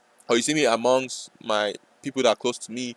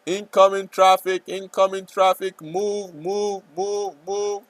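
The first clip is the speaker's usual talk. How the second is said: higher, louder chanted warning; repeated urgent move, higher; mock alarm call; 8 semitones higher, 3 dB louder, slower than usual. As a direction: call it out like a siren. Mock alarm over chart.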